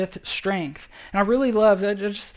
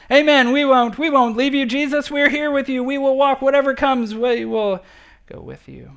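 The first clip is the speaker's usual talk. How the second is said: louder and higher, eager group vow; disciples chorusing assent; 6 semitones higher, 6 dB louder than usual. Retold or imitated. Preacher voicing disciples swearing loyalty, eager and loud. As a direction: call it out like eager disciples pledging. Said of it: "the other ten echoing their assent".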